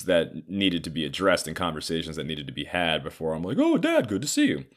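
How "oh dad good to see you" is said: mock greeting. Mock fake-friendly greeting.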